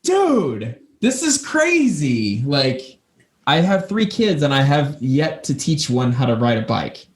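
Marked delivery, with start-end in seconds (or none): 0.0-2.4 high, slow and amazed. Retold or imitated. Telling a friend about a kid's bike, high amazed. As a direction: marvel at it, stretching crazy.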